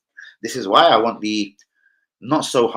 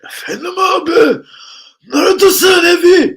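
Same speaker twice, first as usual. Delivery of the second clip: much higher, loud shouting Turkish call; Turkish uncle voice; 11 dB louder than usual. Retold or imitated. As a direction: yell like a Turkish uncle calling across the house. Mimicking Turkish relative.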